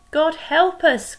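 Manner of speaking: high, loud, slow cry for help; a frightened sailor in a storm calls out; panicked cry. Acted. Teacher voicing a sailor in the storm, panicked cry. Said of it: "cried a sailor".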